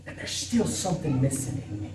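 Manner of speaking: soft, subdued quote of a troubled admission; hushed retold confession. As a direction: let it drop to a quiet confession. Retold confession of spiritual emptiness.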